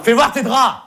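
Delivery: insist, voice up; demanding to see the sheets. Acted. Repeats louder, insisting.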